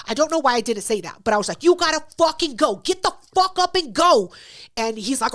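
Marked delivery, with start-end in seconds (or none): 1.6-4.3 angry yelling. Retold or imitated. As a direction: roar at him to get out. Throwing the guest out.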